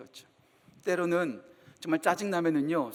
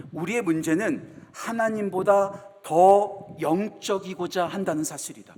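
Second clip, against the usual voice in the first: slow repeat; 2 semitones lower, slower than usual. Shown as a demonstration. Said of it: "다시 말씀드릴게요".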